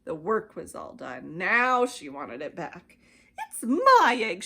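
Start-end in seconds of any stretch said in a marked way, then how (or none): 3.4-4.4 loud indignant sputter. A narrator's reading. Maisie sputtering at Horton over the egg. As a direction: sputter it angrily. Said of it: "she sputtered".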